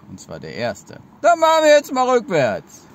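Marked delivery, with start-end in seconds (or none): 1.2-2.8 excited announcement. Real, not acted. Announcing a backwards trick.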